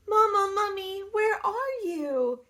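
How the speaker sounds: a lost little mummy calling; little baby mummy. Acted.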